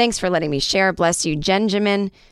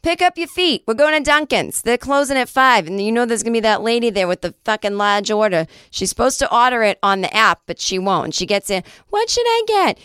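put-on threatening Boston accent; 4 semitones higher than usual. Host imagining sister as a pushy Boston local, threatening accent. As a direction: bark it like a Boston local losing patience. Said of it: "Sounds like a threat".

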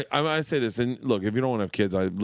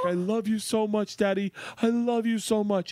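retold sobbing; 13 semitones higher than usual. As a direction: mimic a daughter crying out her love. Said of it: "and she's sobbing".

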